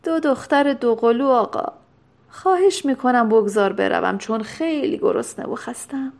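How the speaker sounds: mildly irritable, pleading to be left alone; a touch of peevish impatience; grumpy. A narrator's reading. Hungry, tired doctor begging to go, grumpily. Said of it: "دکتر با کجخلقی تمام پاسخ داد".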